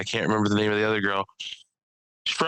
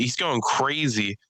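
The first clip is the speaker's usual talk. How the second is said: normal talking voice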